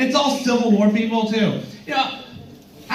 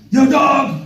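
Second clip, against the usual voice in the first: loud, higher dude hollering; yelled street greeting; 5 semitones higher, 4 dB louder than usual. Retold or imitated. Tour guy hollering at the comedian. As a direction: call out like a pushy tour hustler.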